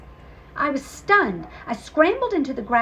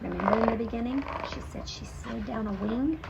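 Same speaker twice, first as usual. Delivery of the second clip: ordinary delivery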